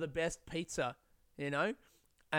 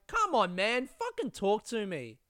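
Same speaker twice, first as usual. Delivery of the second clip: higher, slow exasperated plea; 5 semitones higher than usual. Acted. Podcaster answering Zach's stiff form email, exasperated. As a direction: drag it out, begging for a human reply.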